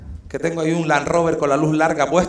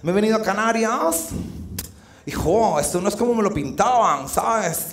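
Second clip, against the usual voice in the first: put-on a touch of a whiny, softer mainland girl; lightly mock a disappointed tourist on the phone; lightly voiced tourist girl complaining about Canarias; whiny godo girl; 6 dB quieter than usual. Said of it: "se puso así y dijo".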